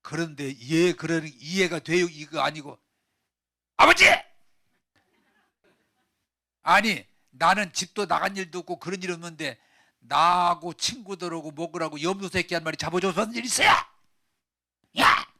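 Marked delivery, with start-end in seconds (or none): 3.8-15.3 loud complaint to a father; angry shouted protest. Acted. Older brother complaining he never got a goat, angry. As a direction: protest the unfairness, bursting into yells. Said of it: "제가 소리 지르니까".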